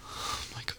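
breathless fed-up whisper; exasperated whisper. Acted. To herself after another telling-off, exasperated whisper. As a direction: sigh the words out.

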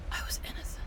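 breathy whisper, almost gone. Acted. Villain whispering to the captive hero about her lost innocence. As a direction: let the memory slip out in a whisper.

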